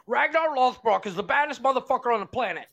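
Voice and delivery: loud, high and fast rave; excited rave. Retold or imitated. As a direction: gush loudly about your favourite show. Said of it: "you'll come on here going".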